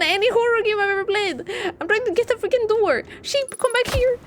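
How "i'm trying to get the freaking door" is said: usual speaking voice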